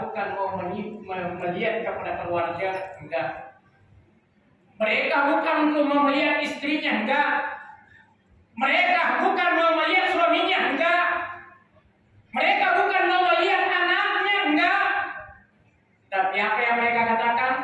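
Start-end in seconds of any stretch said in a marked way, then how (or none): 4.8-14.8 high, fast, insistent preaching; full-force preaching. Real, not acted. Preacher tells congregation what the dying do not want, high and insistent. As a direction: drive each repeated denial home, high and quick.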